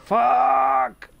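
high, loud curse; startled shout. Real, not acted. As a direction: blurt it out in shock. Ambushed in game.

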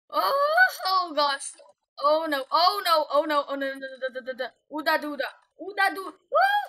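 loud, high yelping that tumbles into gibberish; frantic panic. Real, not acted. Player losing control of the game, frantic loud panic. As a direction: panic loudly, babbling and squealing nonsense syllables.

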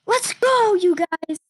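high victorious shout; excited cheer. Real, not acted. Celebrating survival to viewers.

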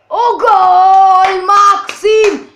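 loud, high goal shout for Maxim. Real, not acted. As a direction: yell it out as the goal goes in. Goal scored in football game.

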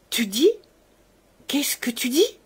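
immense suppressed emotion. A narrator's reading. Shaken father questioning the servant, with swelling emotion. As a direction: push the question out with mounting emotion. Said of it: "où palpitait pourtant une émotion formidable".

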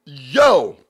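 loud, drawn-out "yo" of disbelief; astonished outburst. Real, not acted. Host reacting to an outrageous rap clip, astonished outburst. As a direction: burst out in real shock.

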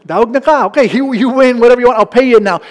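loud, fast, laughing surrender; conceding defeat. Retold or imitated. Preacher gives up haggling with the Russian seller, loud and fast. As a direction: give in all at once, loud and quick. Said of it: "I go".

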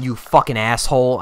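ordinary talk, nothing marked

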